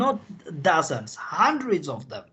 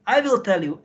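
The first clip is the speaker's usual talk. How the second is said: ordinary talk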